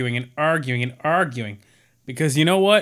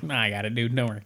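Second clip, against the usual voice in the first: lightly imitated offhand brush-off, low and soft; nonchalant; 5 semitones lower, 7 dB quieter, faster than usual. Ghost-possessed Tucker brushing off Danny, mock nonchalant. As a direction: toss it off casually, quieter and lower. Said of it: "Tucker did it so nonchalantly".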